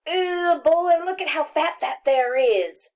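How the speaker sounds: high, loud country holler across the yard; a boy hollering about a fat squirrel. A narrator's reading. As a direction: call it out loud to the whole playground. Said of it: "hollered out".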